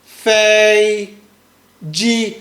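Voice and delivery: 一声"飞机"; first-tone fei ji. Shown as a demonstration. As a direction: hold each syllable of "Fei ji" flat. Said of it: "Read after me".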